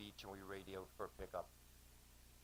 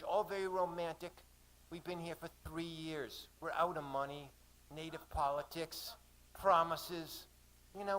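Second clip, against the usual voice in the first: higher-pitched Australian woman's voice; acted Aussie woman, trapped and worn down; Aussie accent; 6 semitones higher than usual. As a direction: tell her troubles in an Aussie accent. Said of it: "she said in an Aussie accent".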